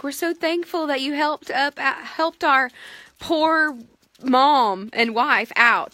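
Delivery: high, overly grateful family voice; gushing thanks. Retold or imitated. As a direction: thank him with sugary gushing. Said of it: "waving at him like".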